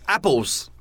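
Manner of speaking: mock British single-word utterance; one-word British emphasis. Teasing an American about British speech, one-word British emphasis. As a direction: toss out one isolated word, British-style. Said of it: "only say the one word".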